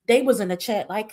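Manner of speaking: normal talking voice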